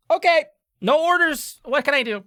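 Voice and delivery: high, loud character voice; exasperated tinkerer. Acted. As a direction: give in loudly, pitched right up. Interrupted tinkerer gives in, haggles.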